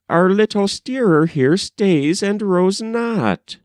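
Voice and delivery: a lightly voiced girl explaining to the king; maidens' reply. A narrator's reading. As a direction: answer the king as a shy maiden.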